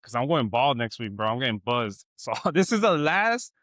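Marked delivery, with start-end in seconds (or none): none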